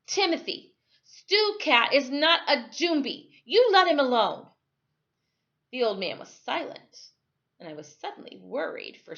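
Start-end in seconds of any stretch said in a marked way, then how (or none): 0.1-4.5 put-on louder and higher, an angry boy's protest. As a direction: snap at him to leave the cat alone.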